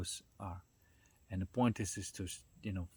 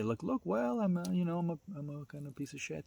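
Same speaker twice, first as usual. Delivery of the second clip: much higher, whiny self-pity with a shrug; mock the loser who gives up on himself, pitched up high; self-pitying whine; 8 semitones higher than usual. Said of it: "You don't say".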